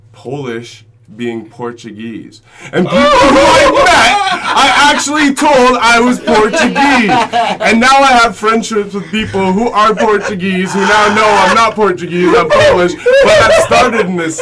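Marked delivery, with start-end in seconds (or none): 2.7-14.4 high, loud, laughing through the words; laughing confession. Real, not acted. Teller admits to friends he claimed to be Portuguese, laughing loudly. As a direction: confess it cracking up, voice way up.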